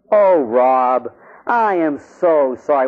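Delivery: slow, drawn-out mock contrition; acted apology. Priest apologizing to Rob after beating him, slow mock contrition. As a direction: stretch out each word of the apology. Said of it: "I go up to him and I go".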